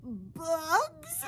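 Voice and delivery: horrified stutter; high shocked stammer. Acted. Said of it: "stuttered one".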